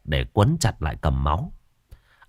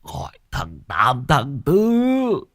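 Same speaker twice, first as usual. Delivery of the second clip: irritated wounded man; 14 semitones higher, 4 dB louder, slower than usual. Acted. Annoyed injured man snaps.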